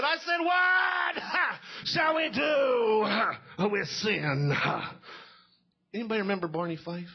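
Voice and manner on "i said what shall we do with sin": very high, drawn-out pulpit shout; bulldog preacher. Acted. Preacher strapped to the pulpit asking once more, full-force mock shout. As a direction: wail it slowly, huffing hard between phrases. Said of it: "he strapped it like a Rottweiler bulldog".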